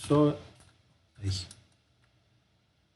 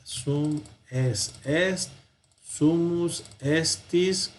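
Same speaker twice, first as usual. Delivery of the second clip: very slow word-by-word recitation; recitado para memorizar; much slower than usual. Shown as a demonstration. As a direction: space out each Latin form for learners. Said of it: "Hasta que no lo aprendamos". (recitado muy lento, palabra por palabra)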